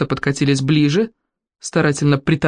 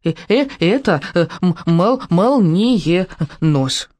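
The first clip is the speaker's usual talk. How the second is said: halting little dragon, stretching out the last word; заикающийся дракончик; much slower than usual. A narrator's reading. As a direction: stammer it out syllable by syllable. Дракончик сообщает, заикаясь от волнения. (дракончик, запинаясь и растягивая слово)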